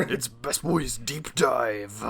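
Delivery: drawn-out announcer intro; slow hype intro. Acted. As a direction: stretch it like a hype host. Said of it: "We have to be, like, cool and edgy".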